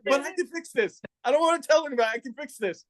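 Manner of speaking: high, rushed panic, talking himself into it; pleading with himself that it's fixable; frantic self-talk. Retold or imitated.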